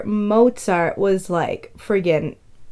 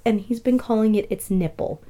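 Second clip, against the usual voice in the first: normal talking voice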